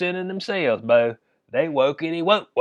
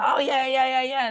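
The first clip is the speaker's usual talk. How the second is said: high, hyped-up mock cheer; 8 semitones higher than usual. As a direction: imitate giddy teammates cheering. Mimicking softball players cheering.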